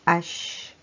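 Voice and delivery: name the letter H, aitch; the letter H spoken on its own. Shown as a demonstration. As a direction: say the letter H clearly. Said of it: "on retrouve la lettre".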